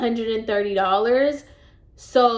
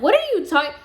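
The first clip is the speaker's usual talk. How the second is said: shocked disbelief; 7 semitones higher, faster than usual. Retold or imitated. To the boss denying her pay, shocked indignation. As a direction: cut her off, stunned and offended. Boss claims she did nothing.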